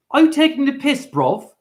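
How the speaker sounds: a lightly hammed-up London quip; London accent. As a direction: toss off a cheeky London jab. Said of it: "They say this".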